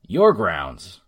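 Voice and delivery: loud scornful sneer. A narrator's reading. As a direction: spit it back with contempt. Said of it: "said Tom with a sneer".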